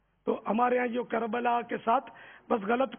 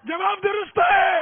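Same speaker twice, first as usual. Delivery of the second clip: high, loud, drawn-out quiz-host shout; triumphant announcement; 9 semitones higher, 7 dB louder, slower than usual. Real, not acted. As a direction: proclaim the right answer at full voice. Quiz host announces correct answer.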